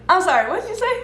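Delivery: high, laughing double take; retold disbelief. Asking a man who confessed killing, mock-polite disbelief. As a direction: act stunned, pitched high through a laugh.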